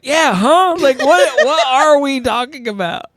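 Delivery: hammed-up bafflement; mock confusion. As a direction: echo her confusion back. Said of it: "And I'm like".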